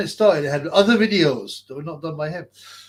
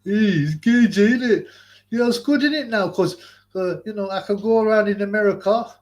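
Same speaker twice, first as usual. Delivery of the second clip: a much higher, hammed-up puppet voice; 7 semitones higher, 3 dB louder than usual. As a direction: play the puppet chatting in slang, pitched way up. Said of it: "I'm gonna do a little puppet show".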